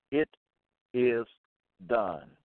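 each word set apart by a long pause; it, pause, is, pause, done, falling on done; "It ... is ... done", measured beats; spaced emphatic refrain. Real, not acted. Slow preacher's closing refrain.

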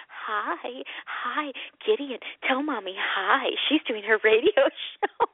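slow, soft, drawn-out hello; mock greeting. Husband ten feet away during radio show, slow soft greeting. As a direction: coo it softly, stretching out the words. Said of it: "makes me go".

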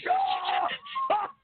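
sudden high-pitched laugh; cracking up at the clip; real high laugh bursting out; high burst of laughter. Real, not acted.

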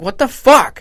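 high, loud mock yell; reliving the outburst at the kid; retold yell. Shouting at a kid who smashed his ice cream. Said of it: "I yelled at this kid".

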